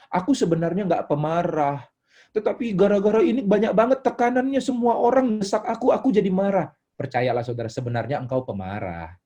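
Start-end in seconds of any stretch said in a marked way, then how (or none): 0.1-6.7 high-pitched mock excuse; self-excusing believer. Church member blames pressure for anger, acted high and protesting. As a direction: plead your case in a raised, protesting voice. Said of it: "Kalau saudara bilang".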